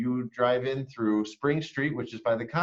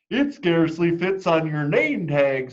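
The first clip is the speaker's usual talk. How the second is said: higher, louder mocking child; teasing classmate; 7 semitones higher, 7 dB louder than usual. Classmate Rita mocking the name, higher and louder. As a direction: point and tease like a know-it-all kid. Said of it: "said Rita".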